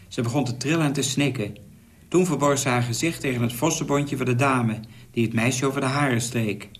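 plain reading voice

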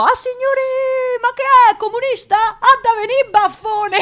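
high, loud mock Roman catcall; mock yell of a Roman lad teasing her red jacket; imitating a jeering youth. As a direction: shout it like a cheeky street boy teasing her. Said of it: "uno di essi mi strillò".

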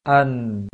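"An" loud, the n held and ringing; nasal nun sukun. Shown as a demonstration. Teacher demonstrating a sustained nun, loud. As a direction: keep the n sounding at the end. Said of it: "Seperti itu dia di ujungnya".

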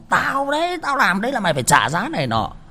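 high, loud gloating taunt; mock jeering kid. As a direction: crow it like a smug brat. Hai thằng cười chế nhạo. (giọng thằng nhóc hả hê chế nhạo, cao và to)

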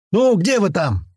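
high impatient call; shouting. A narrator's reading. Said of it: "крикнул Митяй".

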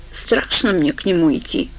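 usual speaking voice

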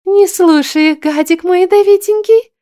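put-on high cooing baby talk. A narrator's reading. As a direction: coo to the poisonous plant. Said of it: "продолжала сюсюкать Дарла".

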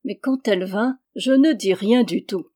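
usual speaking voice

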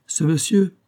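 plus haut et plus fort; avec éclat. A narrator's reading. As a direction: lancer avec défi. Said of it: "fit Rose avec l'éclat". (éclat de voix provocant)

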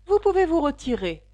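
high, loud dismissal; imperious king. A narrator's reading. The king dismisses the hatter from court, imperiously. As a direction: send the witness off regally.

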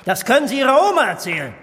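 fast, loud brush-off; mock him loudly and quickly; loud scoffing. Minister dismissing the director's refusal, loud fast scoff.

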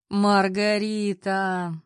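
slow, loud, laughter-choked name; barely able to speak for laughing. A narrator's reading. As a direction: squeeze the name out through helpless laughter. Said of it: "еле выговорил Воронцов".

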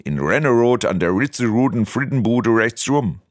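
Westerwald accent with every R rolled; demonstrated Siegerland and Westerwald accent. Shown as a demonstration. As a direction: roll the R's the Siegerland way. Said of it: "wird das R gerollt".

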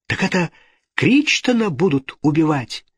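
high-pitched horrified question; shocked cry. A narrator's reading. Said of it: "вскричала итальянка".

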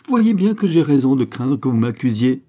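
choked outcry. A narrator's reading. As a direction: burst out in distressed protest. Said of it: "suffoquée".

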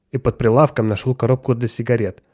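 ordinary delivery

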